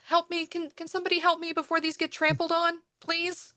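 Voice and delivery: put-on high, fast pleading young woman; distressed plea. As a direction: beg for help in a panic.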